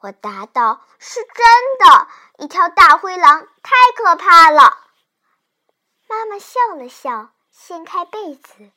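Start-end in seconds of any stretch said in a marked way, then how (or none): 1.0-4.9 put-on loud, emphatic frightened child; alarmed insistence. Child convinces mother the wolf is real, loud and alarmed. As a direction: insist loudly, desperate to be believed.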